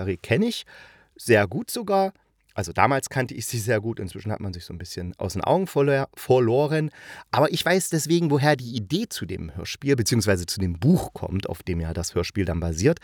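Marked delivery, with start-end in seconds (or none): none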